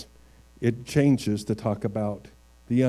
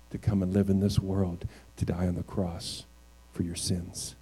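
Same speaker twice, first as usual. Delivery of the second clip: tender appeal; 2 semitones lower, 4 dB quieter than usual. Real, not acted. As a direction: speak softly to someone who doesn't know Jesus.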